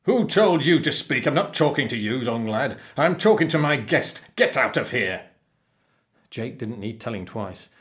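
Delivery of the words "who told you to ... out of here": loud, angry pirate tirade; roaring captain. A narrator's reading. Captain throws the boy out of his cabin, roaring. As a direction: bawl the boy out and dismiss him. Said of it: "roared the captain".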